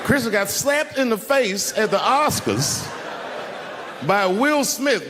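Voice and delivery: slow, high, laughing through the words; can barely get it out for laughing. Real, not acted. Laughing retelling the slap.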